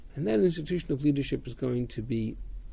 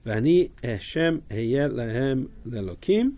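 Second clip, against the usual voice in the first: slow scripture reading; slower than usual. Acted. Teacher reads Hebrew verse aloud.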